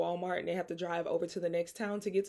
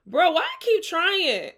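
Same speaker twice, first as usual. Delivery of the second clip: exasperated; 10 semitones higher, 7 dB louder than usual. Retold or imitated. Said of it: "you like".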